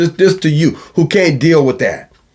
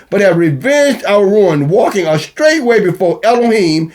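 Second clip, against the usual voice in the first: raised, ringing scripture recital; scripture reading; 4 semitones higher than usual. Acted. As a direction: read it out like holy writ.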